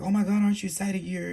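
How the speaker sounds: high, bright-eyed gush of congratulation; gushing excitement. Retold or imitated. Everybody congratulating the new graduate, high excited gush. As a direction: play everyone thrilled for the graduate.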